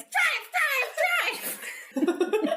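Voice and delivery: high, squeaky raccoon shout; little raccoon voice. Acted. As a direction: yell it like a tiny raccoon. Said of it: "shout at him in my little raccoon voice".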